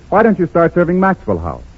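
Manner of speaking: normal talking voice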